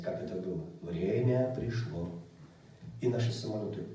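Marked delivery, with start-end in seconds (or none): 0.8-2.1 low, slow, solemn voice from a dream; dreamy distant voice. Retold or imitated. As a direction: draw it out low and slow, like a voice from above. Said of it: "Я даже стараюсь тембр вам передать".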